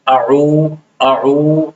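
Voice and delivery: "اعو" said from the throat, vowel lengthened; stretched ain. Shown as a demonstration. Teacher drilling the class on a drawn-out "اعو". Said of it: "اس کو کھینچنا ہے".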